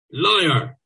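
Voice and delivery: high, sharp shout; shouted accusation. Acted. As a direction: yell it as an accusation. Watchman confronts Bloom with truncheon.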